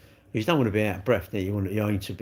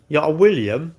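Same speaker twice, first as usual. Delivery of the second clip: teasing jab, raised voice; mocking tease; 5 semitones higher, 6 dB louder than usual. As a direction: rib him mockingly. Mocking insult, far voice.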